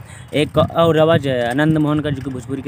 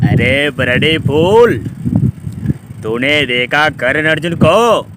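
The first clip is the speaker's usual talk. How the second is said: slow, louder menacing villain voice; N S Puri impression; 6 dB louder, much slower than usual. Villain demanding about Karan Arjun, slow loud menace. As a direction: drag each word out like a film villain. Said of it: "तो N S Puri जी बोलता है".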